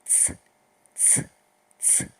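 soft whispered "ц". Shown as a demonstration. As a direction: barely breathe the "ц" sounds. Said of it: "А тепер тихіше".

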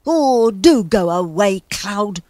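loud, grumbling complaint; fed-up shout, easing on the last word; grumpy shout. A narrator's reading. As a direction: shout it crossly to shoo someone off. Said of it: "Rufus shouted grumpily".

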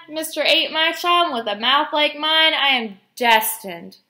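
animated singsong rhyme; reading to children. A narrator's reading. Guest reader telling of squid scolding mopey fish, lively storytime read. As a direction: play up each line for young listeners.

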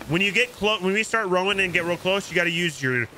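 ordinary delivery

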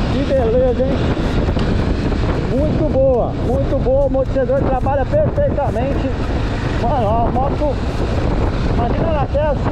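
high, excited voice over the ride. Real, not acted. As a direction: call it out while riding over cobblestones. Demonstrating suspension while riding cobblestones.

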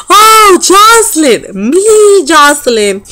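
high, slow mocking sing-song. Mocking everyone who kept calling her Jocelyn, a high, slow sing-song. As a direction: stretch the name out to mock them.

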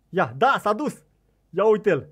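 excited yell. Real, not acted. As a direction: call it out as the fish takes off. Fish runs with the bait.

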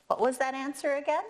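high, quick prompting question; kindergarten teacher voice. Acted. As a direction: coax the children to answer. Prompting kids for answer.

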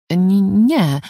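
a small, stuttered inner squeal; squeaked in thought. A narrator's reading. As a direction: let a startled little squeak slip out. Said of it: "pisnęłam w myślach".

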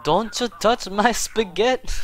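high, slow hammed-up Russian accent; terrible Russian accent. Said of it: "terrible Russian accent".

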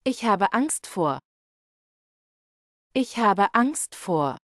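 ordinary talk, nothing marked

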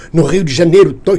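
ordinary delivery

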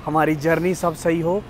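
ordinary talk

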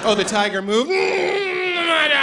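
unsure oh, oh, then a high forced magic; faking getting it. Retold or imitated. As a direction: pretend you understood the trick. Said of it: "They're like".

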